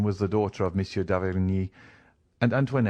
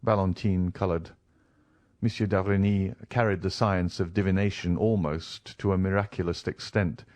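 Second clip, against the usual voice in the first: ordinary delivery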